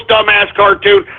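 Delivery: normal talking voice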